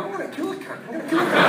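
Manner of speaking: high, hushed little voice; squeaky half-hushed mock menace; mock crazed viewer. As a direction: pretend a horror film turned you murderous. Mocking an imagined horror film viewer.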